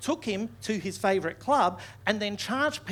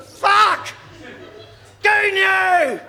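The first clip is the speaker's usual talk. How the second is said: hammed-up grief-stricken yell, much higher and louder; anguished wail; 10 semitones higher, 7 dB louder than usual. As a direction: howl the name like a mourner. Friends wailing over dead rapper.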